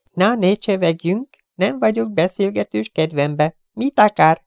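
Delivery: high, curt, insolent gangster voice; rude Chiefen. Acted. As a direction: sneer the intruder off. (magas, pimasz, goromba hang)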